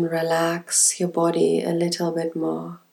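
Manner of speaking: normal talking voice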